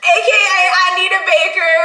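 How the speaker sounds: loud, playful call-out of the misheard words; a teasing, hammed-up shout; joking shout. Real, not acted. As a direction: belt the joke out. Joking shout after misheard line.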